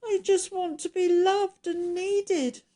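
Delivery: high, whimpering creature voice; tearful, pitiful monster pleading to be loved; fighting back tears. A narrator's reading. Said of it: "Trying hard not to cry so much".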